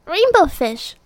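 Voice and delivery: high, loud little fish voice; calling out. Acted. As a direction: call after him eagerly. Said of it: "he called".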